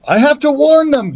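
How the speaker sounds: high, pushed shout; shouting over noise. A narrator's reading. Jack shouting to Thomas over engine noise, high and urgent. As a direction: yell it over roaring jet engines. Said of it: "he shouted to Thomas above the noise".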